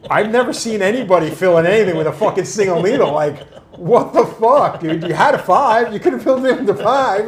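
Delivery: loud, high-pitched disbelief; incredulous retold outburst. Client astonished by single-needle filling, loudly incredulous. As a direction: exclaim in hammed-up astonishment.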